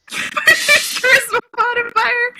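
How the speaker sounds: high squeaky laugh through the words; giggling as he reads it out. Real, not acted. Player cracking up reading rule table, laughing.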